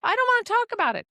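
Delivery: high, fast whine, hammed-up; mock whiny patient. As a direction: mimic a patient refusing to listen. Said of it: "patients I've had that be like".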